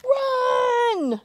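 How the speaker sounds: high, loud panicked shout; witch shouts run. Acted. The witch yelling at the monsters to flee, loud high shout. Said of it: "the witch shouted".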